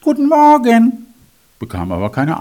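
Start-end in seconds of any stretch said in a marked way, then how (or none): 0.0-1.1 high, loud cheerful greeting; calling out. A narrator's reading. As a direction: call out to the house. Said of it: "Es rief".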